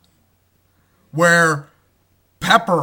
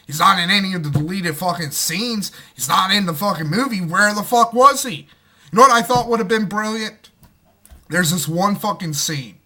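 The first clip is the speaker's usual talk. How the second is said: ordinary talk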